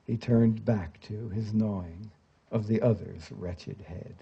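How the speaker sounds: hushed, grave verse narration, falling at phrase ends; poetry recitation. A narrator's reading. Father recounts his sons' deaths, grave hushed recitation. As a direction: recite slowly and bleakly, dropping soft at each death. Recites a translated poem aloud.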